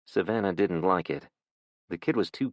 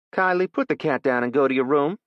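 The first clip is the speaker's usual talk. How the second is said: higher, louder firm order; stern mother; 8 semitones higher, 6 dB louder than usual. Acted. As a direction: send the girl to her room, no arguing. Mother sends child away.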